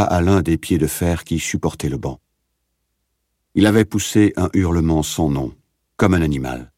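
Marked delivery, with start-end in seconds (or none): none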